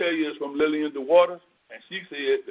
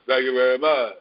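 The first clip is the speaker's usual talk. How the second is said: a hint of [name], low and drawled; mock [name] thank-you; 3 semitones lower, 3 dB louder than usual. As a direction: lightly play the King saying thanks. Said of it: "in my [name] voice".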